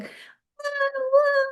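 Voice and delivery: high yelping wah of a dog; high dog whine. Retold or imitated. Storyteller voicing the dog on the nail, high whining. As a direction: whine like a hurt little dog.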